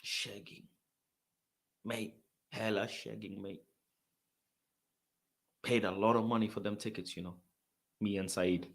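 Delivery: low, very soft, dejected grumble; depressed muttering. Retold or imitated. Cheated fan trudging to ticket office, depressed. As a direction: mutter it to yourself in defeat. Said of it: "I'm walking depressed".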